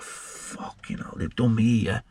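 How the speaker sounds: hushed whispered swear, slowed; whispered dread. Retold or imitated. Prisoner thinking to himself, slow whispered dread. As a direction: breathe it out as a private dread. Inner thought on arriving.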